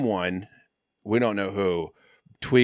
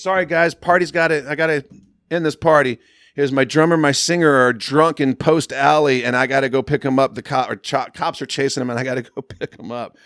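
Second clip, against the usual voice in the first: rushed apology; 5 semitones higher, 10 dB louder than usual. Retold or imitated. Mike ending party in a rush.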